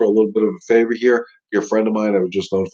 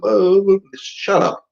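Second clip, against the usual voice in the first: high, slow, drawn-out brush-off; mock dismissive; 11 semitones higher, 3 dB louder, much slower than usual. Trainer brushing off owners' muzzle fears, slow and high. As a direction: wave the silly worry away, slowly.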